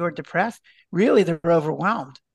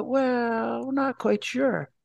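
higher, slower, lightly voiced hedging expert; a hint of a dithering lecturer, pitched up; unsure lecturer; 6 semitones higher, 3 dB quieter, much slower than usual. Doctor mimicking lecturers dodging his question, a light hesitant voice. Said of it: "And they go".

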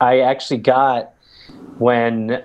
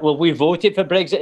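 slightly hammed-up Scottish accent, higher pitch; 5 semitones higher than usual. Quoting his family on their Brexit vote, Scottish accent. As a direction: imitate Scottish relatives. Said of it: "Everybody's Scottish".

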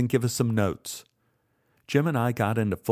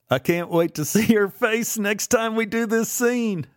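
high, gleeful voice breaking into laughter; laughing glee; 11 semitones higher than usual. A narrator's reading. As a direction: laugh through the words, delighted. Jim gleeful after the chase.

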